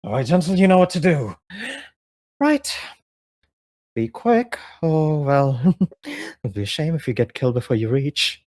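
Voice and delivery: lightly voiced, teasing commander; Dagon's voice. Dagon sends Gentle ahead, lightly teasing. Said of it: "he's like".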